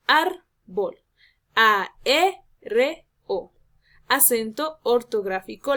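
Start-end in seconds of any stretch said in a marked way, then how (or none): 0.1-1.0 "árbol" as ÁR... bol, first syllable loud and rising; stress on accented vowel. Shown as a demonstration. Teacher giving an example, ÁR of "árbol" stressed. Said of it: "en la vocal que lleve". 1.6-3.5 "A R O" very slow, each piece separate and loud; syllable-by-syllable stress. Shown as a demonstration. Teacher giving an example, separated stressed syllables in "A R O". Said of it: "la mayor entonación de voz".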